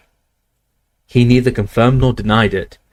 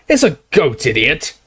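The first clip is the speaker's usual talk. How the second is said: much higher mocking roar; roaring barman; 5 semitones higher than usual. Barman roaring scornfully at the Death Eater, higher. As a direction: scoff it at him. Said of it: "roared the barman".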